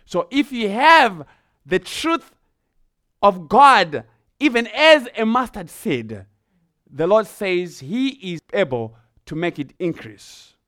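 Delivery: rising, forceful sermon delivery; preaching. Acted. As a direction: press the point home to the congregation.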